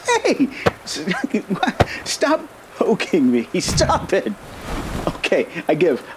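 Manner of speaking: high squealing laughs, pleading stop; laughing while tickled. Real, not acted. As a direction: giggle helplessly while being poked. Being poked, laughing protest.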